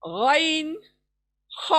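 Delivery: गैन gargled, ख scraped from the upper throat; ghain and kha. Shown as a demonstration. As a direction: recap upper-throat letters. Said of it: "ऊपर के हिस्से से". (ऊपर के हिस्से से गैन, ख)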